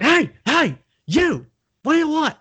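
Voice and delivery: loud, very high mock shout; demonstrated yelling. Shown as a demonstration. Bartender demanding customer's order, loud high mock yell. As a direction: yell for someone's attention, exaggerated. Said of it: "Not yelling, so not like".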